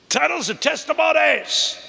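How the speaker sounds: high, musical preacher's delivery. Shown as a demonstration. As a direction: sing the alliteration out.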